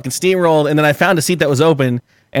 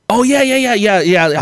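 mock eager usher; 6 semitones higher, slower than usual. Mocking the usher's brush-off.

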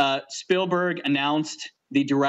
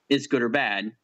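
normal talking voice

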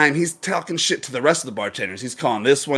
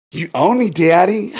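pitched up, louder joke plea; mock submission; 6 semitones higher, 5 dB louder than usual. Bartender joking about better pay, mock submissive. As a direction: play at being happily owned. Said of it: "I'd be like".

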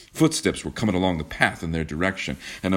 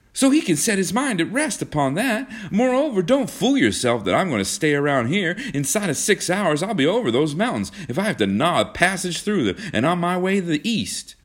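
high, heated, defiant vow; fierce declaration; 12 semitones higher, 3 dB louder than usual. A narrator's reading. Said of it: "cried John fiercely".

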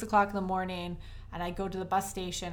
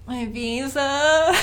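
high, loud, drawn-out question; alarmed disbelief; 6 semitones higher, 8 dB louder, much slower than usual. Retold or imitated. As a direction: echo the request slowly in rising dismay. Retold shock at visa request.

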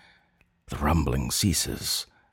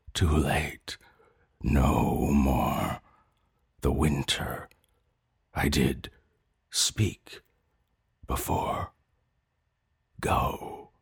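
fading whisper; 3 semitones lower, slower than usual. Acted. Dying mother fading.

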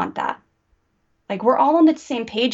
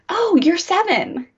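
empathetic parent; 8 semitones higher than usual. Shown as a demonstration. Mom modeling empathy for her little ones, gentle and understanding. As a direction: soothe them like you truly understand. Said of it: "have empathy for your kids".

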